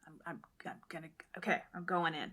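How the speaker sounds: fast stammering whisper; nervous whisper. Retold or imitated. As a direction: steel yourself, whispering. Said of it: "she's like".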